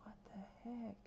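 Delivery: barely voiced, baffled whisper; stunned whisper. Real, not acted. Gamer mutters over the lost builds, stunned whisper. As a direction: whisper it to yourself in disbelief. Baffled by deleted game library.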